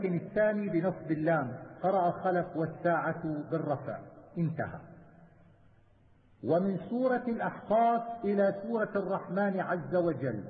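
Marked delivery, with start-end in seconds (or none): none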